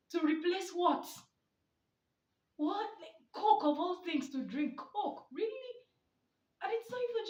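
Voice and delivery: high, loud disbelieving questions; can't believe anyone picks Coke; incredulous. Real, not acted. Mocking viewers who reward themselves with Coke, high disbelief.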